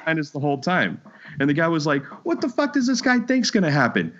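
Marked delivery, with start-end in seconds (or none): 2.2-4.1 incredulous guy imitation. Voicing the incredulous biker.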